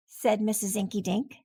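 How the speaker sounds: ordinary delivery, nothing marked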